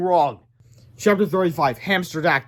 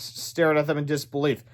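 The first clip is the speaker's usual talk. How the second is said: ordinary delivery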